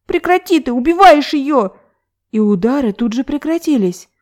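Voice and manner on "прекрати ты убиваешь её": put-on high, sharp child's shout; angry child's cry. A narrator's reading. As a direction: cry out to stop it. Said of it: "детский злой крик".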